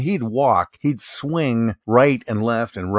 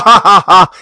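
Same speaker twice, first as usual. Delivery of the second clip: mock ha ha ha, a bit louder; imitated host laugh; 9 semitones higher, 10 dB louder than usual. Copying the podcast host for a friend with a loud mock laugh. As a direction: ham up a choppy staccato laugh. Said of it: "he has one of those wheezy laughs".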